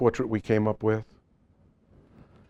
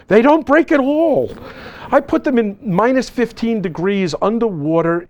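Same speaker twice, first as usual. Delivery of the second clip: emphatic protest; 10 semitones higher, 5 dB louder than usual. Real, not acted. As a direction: insist firmly after an audience member asks if they break. Answering a doubtful audience question.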